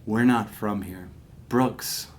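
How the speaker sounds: low, dead-serious father reveal; completely different tone. Retold or imitated. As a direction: deliver it low and grave, as an alien confession. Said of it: "in this completely different tone".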